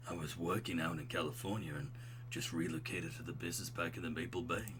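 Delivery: low, soft, laid-back dude voice; smooth, buttery bro. Acted. Craig telling an old roommate about moving, smooth low voice. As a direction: stay deep and mellow.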